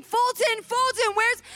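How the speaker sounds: put-on high, urgent calling for a lost child; retold yelling. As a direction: call out his name, reenacting the panic. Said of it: "I'm yelling his name".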